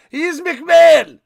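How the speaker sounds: loud, high "McMahon" clipped to "mick-MAN"; mock announcer pronunciation. Shown as a demonstration. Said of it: "You say McMahon".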